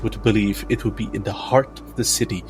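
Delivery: normal talking voice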